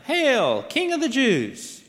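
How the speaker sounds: high, loud ceremonial hail; mock salute. A narrator's reading. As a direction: hail him loudly as a pretend king. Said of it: "they began saluting him".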